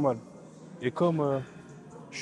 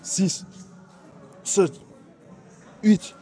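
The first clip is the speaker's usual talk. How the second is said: last set of curls, counting through the pain, louder each rep; straining hard; 5 semitones higher, 4 dB louder, much slower than usual. Real, not acted. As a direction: count rising higher and louder to the end.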